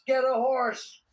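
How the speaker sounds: imitating a loud, high street yell; yelled heckle. Passer-by heckles a man on a bicycle, yelling. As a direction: call it out like a passing heckler. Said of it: "somebody yelled out".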